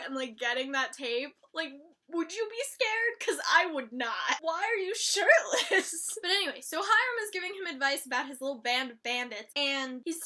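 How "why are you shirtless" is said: loud, slow, high baffled question. Real, not acted. Vlogger baffled by shirtless gang members, high and slow. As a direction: ask it in total disbelief.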